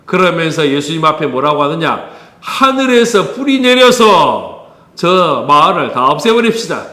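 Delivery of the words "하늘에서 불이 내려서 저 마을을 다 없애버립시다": imitating fiery anger. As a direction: act out hot-headed disciples demanding destruction. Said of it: "아주 불같은 화를 내요". (불같이 화내는 제자들 흉내)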